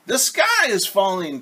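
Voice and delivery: high, urgent cry; alarmed cry. Acted. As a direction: announce the disaster. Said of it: "cried Henny Penny and Ducky Lucky".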